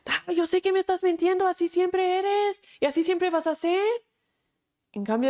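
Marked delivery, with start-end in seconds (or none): 0.0-3.8 higher, louder, fast accusing shout; hammed-up angry outburst; mock yelling. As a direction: throw the accusation at her husband. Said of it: "reaccionar como gritando".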